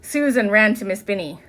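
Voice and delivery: ordinary delivery, nothing marked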